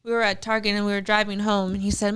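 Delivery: ordinary delivery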